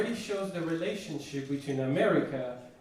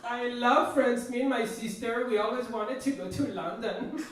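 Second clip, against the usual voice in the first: put-on higher, airy valley-girl gush; gushing American girl; 7 semitones higher than usual. Mocking his first American date Jessica, high gushing girl voice. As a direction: play the excited girl who loves France. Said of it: "And then she was like".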